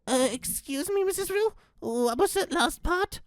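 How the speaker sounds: high, soft, hesitant little voice; timid Fred. Acted.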